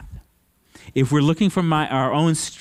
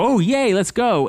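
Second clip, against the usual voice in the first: high, bouncy mock cheer; mock excitement; 8 semitones higher than usual. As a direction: play it as a naive eager yes. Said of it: "He doesn't go".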